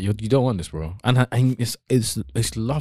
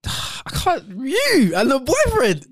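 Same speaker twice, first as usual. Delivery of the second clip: laughing disbelief; much slower than usual. Real, not acted.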